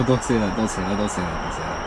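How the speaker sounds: pleading hush. Real, not acted.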